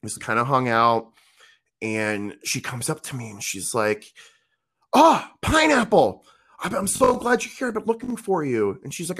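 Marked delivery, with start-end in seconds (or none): 4.9-8.8 put-on high excited girl voice. Girl voiced, glad to see him.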